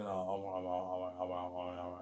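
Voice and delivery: ordinary delivery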